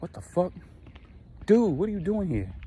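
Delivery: high, startled cry of alarm; shocked outburst. Retold or imitated. Husband spots his lover outside his house, high startled shout. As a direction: caught off guard, yelping in shock.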